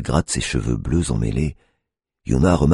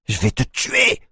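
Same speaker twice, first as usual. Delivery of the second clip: yelled; 8 semitones higher, slower than usual. A narrator's reading. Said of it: "hurle-t-elle".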